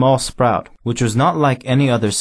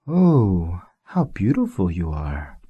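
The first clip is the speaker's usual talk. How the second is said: awed and hushed; 4 semitones lower, slower than usual. A narrator's reading. Prince admires the flower.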